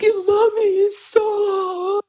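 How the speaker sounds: put-on high, loud child's complaint; whiny daughter. Mother voicing her daughter complaining she is hard, high and whiny. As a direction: squeal it like a protesting little girl.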